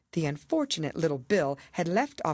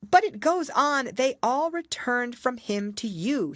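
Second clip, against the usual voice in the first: put-on higher, bolder girl's voice; indignant Alice; 9 semitones higher, 4 dB louder than usual. A narrator's reading. Alice contradicting the queen, indignant. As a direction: object firmly as a young girl.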